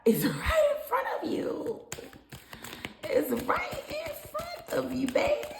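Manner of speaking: high-pitched squeal through laughter; laughing. Real, not acted. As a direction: squeeze the words out while laughing. Breaks up laughing while reading.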